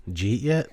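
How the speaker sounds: "Did you eat yet" squashed into "jeet yet"; Jersey slurred pronunciation. Acted. Jersey native showing off for girlfriend, slurred jeet-yet. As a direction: run the question together in Jersey style. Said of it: "Ask me if I ate yet".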